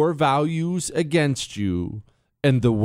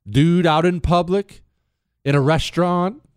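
ordinary talk, nothing marked

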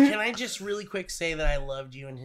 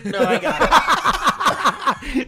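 slow mock cool guy; 7 semitones higher, 6 dB louder, much slower than usual. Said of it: "They're like".

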